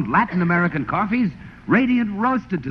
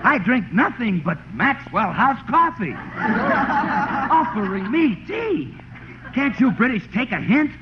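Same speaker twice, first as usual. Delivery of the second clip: hammed-up offended rant, higher; mock indignation; 8 semitones higher than usual. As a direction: play the insulted patriot, over the top. Mock tirade against British tea.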